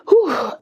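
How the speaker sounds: high breathy laugh, then a long whew; shaky relieved laugh after holding back tears. Real, not acted.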